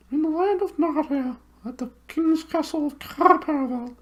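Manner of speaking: very high hooting owl voice, far from the narrator's own; high, hooting bird voice. Acted. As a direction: hoot it like a big owl. Owl voice held.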